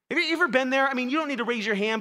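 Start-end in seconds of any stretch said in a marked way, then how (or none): none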